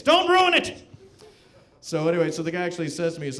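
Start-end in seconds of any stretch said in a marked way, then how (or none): 0.0-0.8 high, loud burst; mock exasperated shout. Husband to wife over amazing job lead, mock-desperate shout. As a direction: yell it as an urgent, hammed-up plea. Hushing his wife, acted.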